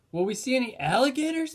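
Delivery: put-on high, fast, loud kid question; eager little boy. A narrator's reading. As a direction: ask like an excited young boy. Benny asks eagerly.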